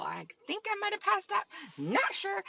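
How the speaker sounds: high, quick unsure muttering; half-joking high squeak of doubt; unsure self-talk. Retold or imitated. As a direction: raise it up, doubtful and fast.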